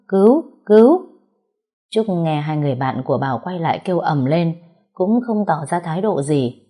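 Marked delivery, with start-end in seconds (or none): 0.1-1.0 high, loud desperate yell for help; crying help. Acted. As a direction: scream for help at full voice. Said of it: "kêu lên".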